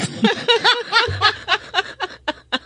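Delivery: long high laugh. Real, not acted. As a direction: laugh out freely. Laughs about earning in dollars.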